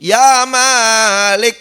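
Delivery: slow, drawn-out loud wail to the angel; pleading cry. Acted. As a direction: wail his name like a begging sinner. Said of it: "Mereka pun berteriak-teriak merayu".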